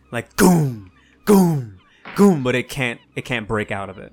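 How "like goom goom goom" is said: a louder, booming imitation of a giant banging the cage; mock banging boom. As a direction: thump out each goom like a fist on bars. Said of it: "it starts banging against the cage".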